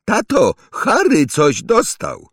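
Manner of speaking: put-on high, slow whining boy voice; tattling child's call. A narrator's reading. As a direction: tell on someone loudly to dad. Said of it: "zawołał Dudley". (wysokie, przeciągłe wołanie skarżącego dziecka)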